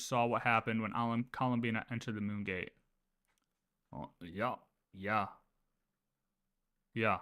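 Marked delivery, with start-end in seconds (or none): none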